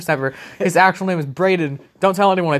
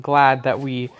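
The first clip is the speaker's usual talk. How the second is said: normal talking voice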